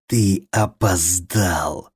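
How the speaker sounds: very slow sneering monster voice. A narrator's reading. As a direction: drag it out, gloating.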